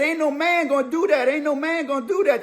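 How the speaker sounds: high, excited exclaim; imitated disbelief. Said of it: "he said".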